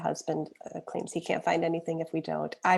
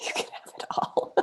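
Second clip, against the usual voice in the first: whisper; 6 dB quieter than usual. Real, not acted. Giving away the oil.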